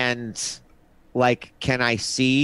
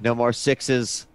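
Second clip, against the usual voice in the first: usual speaking voice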